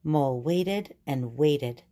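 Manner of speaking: ordinary delivery, nothing marked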